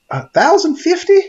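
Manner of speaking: high incredulous rising question; can't believe the number; squeaky disbelieving echo; disbelief. Real, not acted. Incredulous at the payout.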